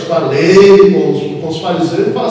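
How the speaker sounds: ordinary delivery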